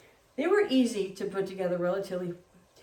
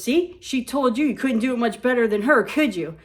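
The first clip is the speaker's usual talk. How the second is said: loud, higher gloating mom imitation; 3 semitones higher, 7 dB louder than usual. Mom gloating at the boss, loud and smug.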